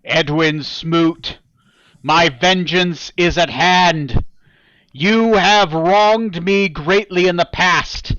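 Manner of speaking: slow, loud, solemn threat; vengeful voice. Acted. Hidden foe threatening Edwin Smoot, slow solemn vengeance. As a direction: declare vengeance slowly to your nemesis. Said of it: "Neil and Yossarian, you hear this".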